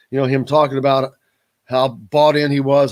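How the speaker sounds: ordinary talk, nothing marked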